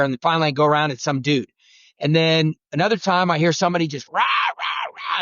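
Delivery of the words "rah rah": high, sharp yelping "rah, rah" like a hen turkey; imitated turkey yelp. Hunter mimics another caller's turkey yelps in the woods, high yelping.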